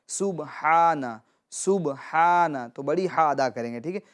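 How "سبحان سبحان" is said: سبحان with a strong throaty ح; heavy h sound. Shown as a demonstration. Teacher showing children the heavy ح in سبحان. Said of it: "یہ بڑی ہا ہے".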